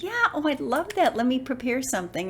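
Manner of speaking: high-pitched, excited yes; re-enacted eager excitement; eager acceptance. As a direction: leap at the offer, pitch way up. Retells jumping at audition offer.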